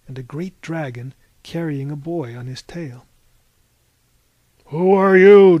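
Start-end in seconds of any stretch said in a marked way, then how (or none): 4.7-5.6 loud, higher booming demand; demanding Cyclops. A narrator's reading. Cyclops challenges the intruders in his cave, loud demanding shout. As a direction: boom it out at the intruders. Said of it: "demanded the Cyclops".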